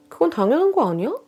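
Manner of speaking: a high, sneering put-on voice for the cruel sister-in-law; spiteful in-law, lightly voiced, sneering at her sister-in-law; mocking sister-in-law. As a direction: toss it off with contempt.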